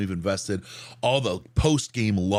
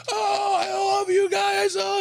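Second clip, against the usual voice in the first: put-on slurring, joyous drunk; 3 dB louder than usual. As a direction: imitate a tanked fan shouting his love. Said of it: "He's slurring so hard through his words".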